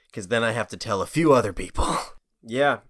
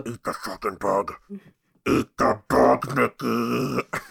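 eerie put-on voice from under something; drawn-out bug-eating temptation, voice disguised; little voice; slower than usual. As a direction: coax slowly in a strange little put-on voice. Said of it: "he heard a little voice".

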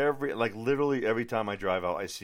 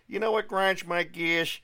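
high, slow cartoon dad voice; Peter Griffin impression; 11 semitones higher, 6 dB louder, slower than usual. Podcaster mimicking Peter Griffin for listeners, high cartoon voice. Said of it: "I sound like ... Peter Griffin".

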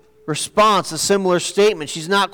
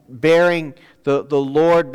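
ordinary talk

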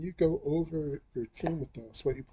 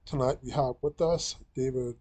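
usual speaking voice

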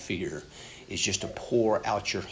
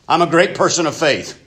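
loud, high, falsely cheerful front; mock-cheerful I'm-fine act, much louder and higher; fake composure; 9 semitones higher, 9 dB louder than usual. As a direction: cover it up with a bright brave face. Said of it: "the worst thing you can do is just to stuff them".

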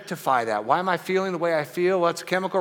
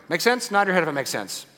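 usual speaking voice

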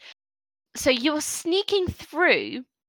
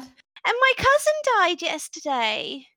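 lightly voiced child; 6 semitones higher than usual. GM voicing orc child.

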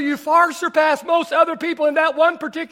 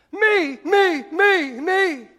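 high, insistent me-me chant; self-centered person; 3 semitones higher, much slower than usual. Acted. First kind of person in the room, self-centered. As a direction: play someone full of themselves. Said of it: "The first kind of person".

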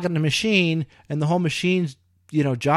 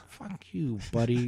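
muttered curse; 3 semitones lower, 8 dB quieter, slower than usual. Retold or imitated. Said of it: "It's like".

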